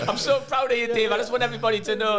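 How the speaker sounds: high-pitched gushing mum voice, laughing; mock proud mum. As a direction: gush like a proud mother, way up high.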